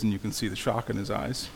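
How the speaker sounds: plain reading voice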